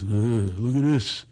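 low, slow, ponderous dad voice; father impression. Father spotting a bargain in a magazine, father impression. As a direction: mull it over slowly, deep in the throat. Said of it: "He goes".

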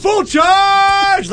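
high shouted nickname; mock shout of a nickname at a passer-by; yelled from a car. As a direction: holler it from a passing car. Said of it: "people are always like".

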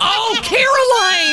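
high, slow delighted shriek; laughing shout. Real, not acted. Cohost shrieks at the guard's verdict, laughing. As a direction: shriek her name in shock.

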